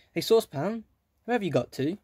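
loud, high call up the tree. Acted. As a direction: shout it up to someone far away. Calling out to Saucepan.

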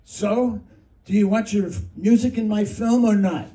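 high, loud imitated outburst; mock furious shout; angry demand. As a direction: bark it like you just banged the table. Bangs his fist on the table.

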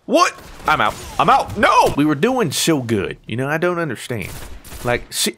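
ordinary talk

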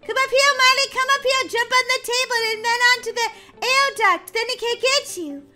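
urgent high calling. Real, not acted. Urgent coaching sister.

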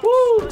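very high, loud whoop; excited whoop. Real, not acted. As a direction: let out a piercing celebratory whoop. Cheer after a close escape.

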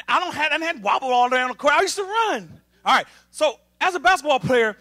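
usual speaking voice

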